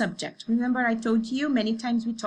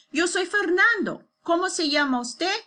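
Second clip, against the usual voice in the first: higher, louder dialogue voice; formal greeting; 7 semitones higher, 4 dB louder than usual. Acted. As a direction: act out the formal introduction brightly.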